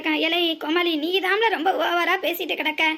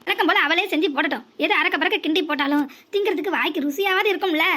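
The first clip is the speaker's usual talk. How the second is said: ordinary talk, nothing marked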